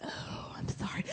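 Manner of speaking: whispered mock condolence; exaggerated hushed pity; mock disappointed. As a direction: whisper it like terrible news. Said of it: "I got the disappointed".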